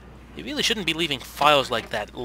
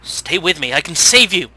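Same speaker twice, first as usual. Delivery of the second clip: loud, hammed-up rescuer's cry; dramatic urgent plea; 3 semitones higher, 10 dB louder than usual. Vampire to dying girl he can save, dramatic and loud. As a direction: build to a loud theatrical promise.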